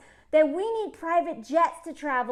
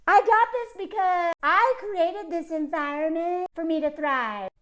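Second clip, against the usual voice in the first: mock smug billionaire; 4 semitones higher than usual. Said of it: "dudes like this are like".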